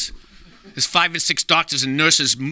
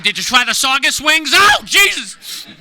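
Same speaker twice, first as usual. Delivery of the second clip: high, loud stretched wail; anguished cry; 8 semitones higher, 5 dB louder, slower than usual. Retold or imitated. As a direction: wail out the humiliation slowly. Mortified punchline, shriek.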